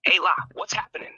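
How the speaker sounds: lightly attempted Scouse accent; a hint of Liverpool Scouse. Shown as a demonstration. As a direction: have a half-hearted go at Scouse. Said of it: "I'll give it a go in me own way".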